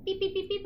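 high beeping imitation; phone beeps.

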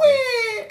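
high, drawn-out fake wah; mock crying wail. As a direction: wail it like a crybaby dealer. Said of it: "cried in my face about it".

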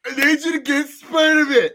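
hammed-up whining friend begging; whiny Harry. Mocking Harry's whining to Peter, hammed-up whine. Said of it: "He's like".

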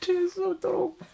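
high, squeaky laughing voice; helpless laughter. Real, not acted. Co-host cracking up over dry wine, high laughing squeal. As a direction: squeeze the words out through laughter.

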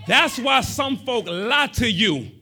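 loud, fervent sermon delivery; preaching. Acted. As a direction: build it up like a preacher. Preaching at full force.

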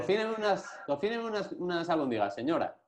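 high, bossy mock request; mock demanding customer. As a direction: order her around playfully. Acting out demanding of a lady.

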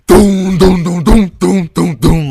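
loud, high booming doom doom; imitated bass thumping. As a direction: mimic a car stereo's blasting bass. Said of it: "It's like".

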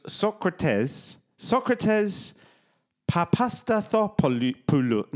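Socrates as SOK-ra-tez, then slow Papastathopoulos; careful demonstration. Shown as a demonstration. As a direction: sound out Socrates Papastathopoulos slowly, struggling at the end. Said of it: "I've been trying this all day".

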